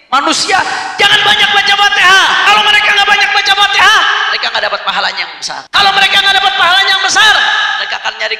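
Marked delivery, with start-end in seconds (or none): 0.1-4.0 high, loud, insistent orders; acted commander's shout. Preacher voicing Iblis instructing devils against Fatihah, high loud command. 5.7-7.3 high, loud commander's cry; acted loud repeat. Preacher voicing Iblis explaining lost reward, high loud shout.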